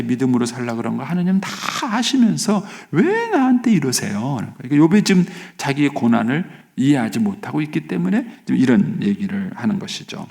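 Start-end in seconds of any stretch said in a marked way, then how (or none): 1.0-4.4 aggrieved protest. Acted. Job asks God why he is treated so, aggrieved protest. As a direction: stretch the words in mild acted grievance.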